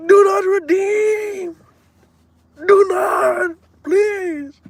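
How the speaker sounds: put-on very high, slow, drawn-out Indian accent; hammed-up high Indian accent; Indian scammer accent. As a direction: stretch it out like a pleading Indian caller. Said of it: "when using that accent".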